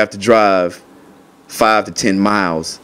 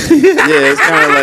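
high, laughing through the words; giggling so hard the words squeak. Real, not acted. Asked to repeat his slang, laughing high. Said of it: "laughing while explaining".

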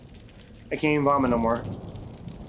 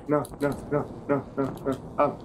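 usual speaking voice

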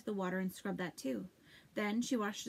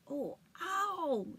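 sharp high yelp of pain; howl of pain; 7 semitones higher than usual. Acted. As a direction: cry out as the sting burns. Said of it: "Sophie howled".

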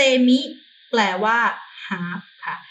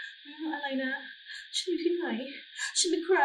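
higher, softer dazed mumble; dazed half-awake; 5 semitones higher, 10 dB quieter than usual. Pretend. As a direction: act groggy, like just coming round. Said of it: "กำลังเอ๋อๆอยู่".

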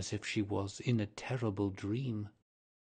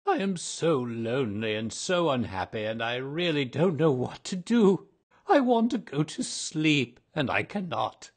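louder, higher lonely lament; pleading ghost; 6 semitones higher, 8 dB louder than usual. Acted. Lonely ghost pleading with Virginia, loud and high. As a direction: pour out his misery, loud.

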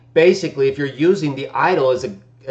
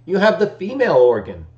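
normal talking voice

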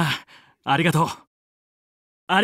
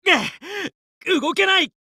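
higher, dragged-out groan of effort; strained; 9 semitones higher, slower than usual. Acted. Frozen pilot straining to move, higher drawn-out groan. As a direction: strain against the ice.

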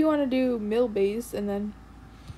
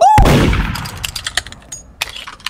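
very high, loud shrieking gasp; scared gasp; 11 dB louder than usual. Real, not acted. Jump scare.

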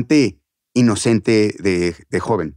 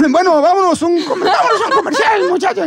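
very high, squeaky host voice; Chabelo. Acted. Kids' host abruptly sending to commercial, very high voice. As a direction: hurriedly cut away in a piping child voice. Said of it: "Chabelo de repente".

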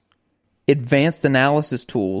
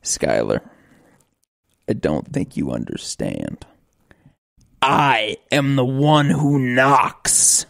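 playing Walt; slower than usual. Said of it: "I'll be Walt".

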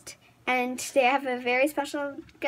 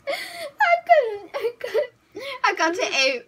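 high, breathless laughing words; 11 semitones higher, 5 dB louder than usual. Real, not acted. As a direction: giggle it out helplessly. Laughing after stuffing challenge.

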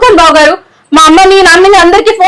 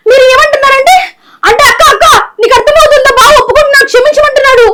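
high, tearful, half sobbing accusation; 6 semitones higher than usual. Acted. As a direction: wail it at the guilty brother-in-law. Sister-in-law confronts cheating husband.

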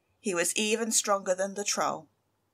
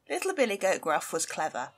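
plain reading voice

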